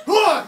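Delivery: loud, high grunt of effort; straining grunt. Shown as a demonstration. Showing guys how men push standing up, straining grunt. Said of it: "you guys are doing the".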